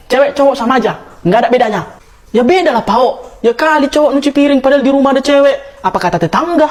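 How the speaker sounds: shouting across the kitchen at a sibling; loud bickering. Acted. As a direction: yell it out, squabbling over chores. Siblings quarrel over dishes.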